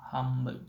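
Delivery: "Hamburg" with m replacing the nd; assimilated pronunciation. Shown as a demonstration. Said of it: "is often pronounced Hamburg".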